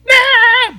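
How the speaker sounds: high, loud panicked scream; retold scream. Everybody in the canyon freaking out, loud high scream. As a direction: shriek as the wall starts crawling. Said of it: "freaking out".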